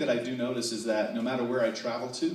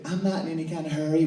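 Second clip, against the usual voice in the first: higher-pitched Texas lady; polite Texan woman; 4 semitones higher than usual. Acted. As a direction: sweetly insist in a high Texan woman's voice. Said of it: "It was a man and a woman".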